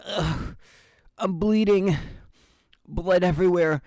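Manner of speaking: usual speaking voice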